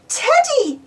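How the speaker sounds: high delighted shout of the name; a happy little girl getting her bear back; joyful cry. A narrator's reading. Peppa gets Teddy back, high joyful cry. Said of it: "cries Peppa".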